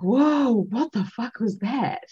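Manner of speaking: amazed swearing; stunned disbelief. Retold or imitated. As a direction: sound like you can't believe what just happened. Group reacts after the clearing.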